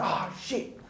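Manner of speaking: mock-upset grumble on a sigh; upset muttered swearing. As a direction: swear to yourself in mild exasperation. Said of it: "He's getting really upset this time".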